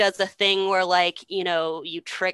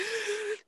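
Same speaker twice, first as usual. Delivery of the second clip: high, hammed-up gasp; theatrical gasp; 9 dB quieter than usual. Mother turning to her sons, theatrical gasp. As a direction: gasp like a fake victim.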